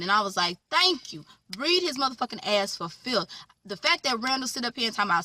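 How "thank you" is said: vindicated, as if she said it for you; emphatic thank you. Real, not acted. Viewer thanking the mother for reading him, high triumphant. Applauding mama's reply.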